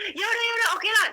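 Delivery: high, exasperated giving-in, re-enacted; forced consent. Giving in to the pressing voice, exasperated. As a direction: give in loudly to end the nagging. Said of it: "Jadi saya dipaksa".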